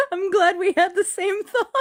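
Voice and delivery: high-pitched, laughing through the words. Real, not acted. Co-host relieved they thought alike, laughing. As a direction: talk through real laughter. Laughing at shared doubt about accent.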